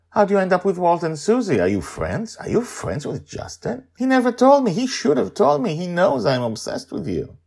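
high, loud fan excitement; starstruck gushing. A narrator's reading. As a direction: gush like an obsessed fan. Sandra gushes over famous Amali.

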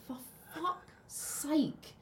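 high, slow, fed-up for fuck's sake; drawn-out exasperated sigh of a line; exasperated mock groan. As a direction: throw up your hands in mock despair. Mocking Irishman casting.

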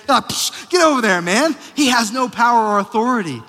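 loud, high, impassioned preacher; full-force preaching. Real, not acted. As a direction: thunder it at the congregation.

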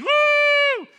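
high mock revival whoop; shrill-free loud mock woo. Mocking hyped-up faith talk.

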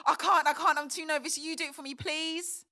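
too wound up to open the envelope; frantic excited begging; nervous excitement. Acted.

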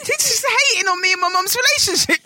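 high-pitched indignant rant; hammed-up outrage; comic exasperation. Real, not acted. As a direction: complain in a high, worked-up voice. Comic rant about Siri not calling.

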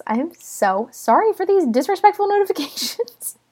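high-pitched, amused apology; laughing apology. Real, not acted. Podcaster apologising to listeners for notifications, laughing and high. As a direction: apologise through real laughter.

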